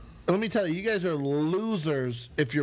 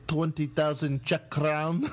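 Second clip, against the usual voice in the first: put-on slow, lightly accented offer; Czech producer.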